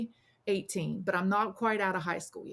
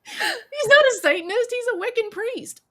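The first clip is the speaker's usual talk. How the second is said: much higher and louder, an exasperated put-on voice; hammed-up, as if correcting a fool; exasperated correction; 11 dB louder than usual. Friend correcting the sheltered teen, loud and exasperated. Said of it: "And he goes".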